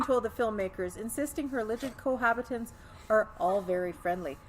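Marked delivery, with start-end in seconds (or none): none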